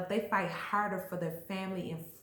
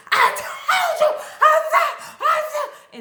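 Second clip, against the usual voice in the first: hammed-up furious, tearful screaming; mock hysterical rage, drawn-out words; crying mad; 16 dB louder, slower than usual. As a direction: shriek it very high and loud, imitating angry crying. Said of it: "and they crying mad".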